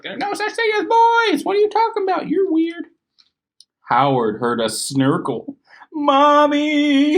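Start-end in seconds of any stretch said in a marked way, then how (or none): 0.0-2.8 very high squeaky mock mother, scoffing; squeaky monster mom. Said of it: "but she's like". 5.9-7.2 high, loud call for mommy; scared boy calling his mom; crying out. Acted. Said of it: "he cried".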